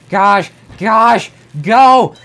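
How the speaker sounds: loud, high cries of exasperation; frustrated yell. Real, not acted. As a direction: vent at the game. Frustrated crash mid-run.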